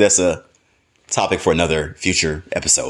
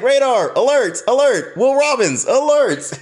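much higher sing-song warning call; mock robot alarm. Mock robot warning to himself about the husbands, high alarm call. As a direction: sound the alarm like a sci-fi robot. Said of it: "I was like".

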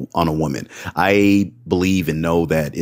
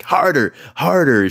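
mock high moaning; 9 semitones higher than usual. Partner's moans quoted in story.

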